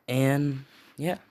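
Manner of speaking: usual speaking voice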